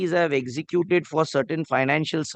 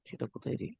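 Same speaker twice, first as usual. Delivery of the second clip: whispered aside; 6 semitones lower, 15 dB quieter, much faster than usual. Real, not acted. Whispered aside to junior in court.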